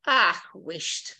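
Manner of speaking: Scottish. Acted. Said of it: "more like the Scottish".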